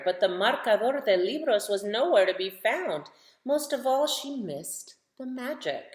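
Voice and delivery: lively picture-book read-aloud for little ones; reading to children. A narrator's reading. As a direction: tell it like story time on the rug, rising with the refrain.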